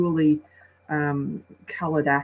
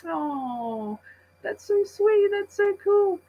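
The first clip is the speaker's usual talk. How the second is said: much higher, swooning mock gush; gushing women; slower than usual. Women at the movie gushing over the sweet bits, mock swooning. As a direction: coo it like a smitten moviegoer. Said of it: "the women are sitting there going".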